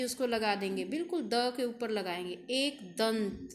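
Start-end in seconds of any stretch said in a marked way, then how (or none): 2.5-3.5 slow एक दंत, nasal sound on द; careful anusvara. Shown as a demonstration. Teacher slowly models एक दंत for pupils. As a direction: stretch एक दंत, nasal after द. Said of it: "द के बाद sound आ रही है ना".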